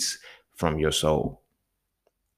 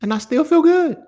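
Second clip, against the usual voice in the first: much higher and louder, bursting with cheer; exaggerated cheerful; 8 dB louder than usual. Shown as a demonstration. Catching his own frown, he declares joy high and loud. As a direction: sing out "feel good" high and bright to prove it. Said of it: "catch myself 'cause I got a mean mug".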